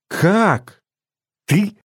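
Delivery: put-on high, stunned girl's questions; squeezed-out disbelief. A narrator's reading. Masha asks her friend how he could help, high strangled disbelief. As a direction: pitch it right up, incredulous. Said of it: "выдавила Маша".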